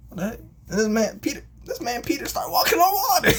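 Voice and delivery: high, loud hammed-up amazement; astonished shouting. Guys in the boat seeing a miracle, amazed shouting.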